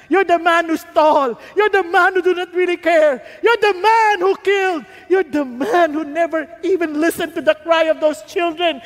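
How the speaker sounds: loud preacher's charges, one after another; mounting accusation. Acted. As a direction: pound each charge home. Nathan confronts David, building.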